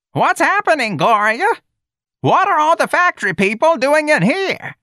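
very high, loud character voice; confused Miss Trixie. Acted. Miss Trixie questioning Ignatius amid the chanting, high and loud. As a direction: cry it out in a piercingly high pitch.

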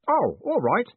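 bright, high eager voice; cheerful young clerk. A narrator's reading. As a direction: chirp it up like a keen boy. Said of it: "said Stanley".

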